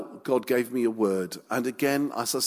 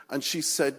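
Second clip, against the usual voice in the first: ordinary talk, nothing marked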